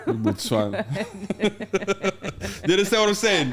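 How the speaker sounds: chuckling through a scolding; laughing mock boss. Boss laughing at Botswana, chuckling scold. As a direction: laugh while lecturing Botswana.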